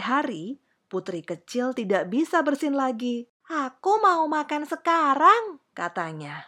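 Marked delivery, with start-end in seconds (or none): 3.5-5.6 put-on high, loud child voice; demanding little princess. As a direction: demand it like a hungry little girl. Hungry princess demands food.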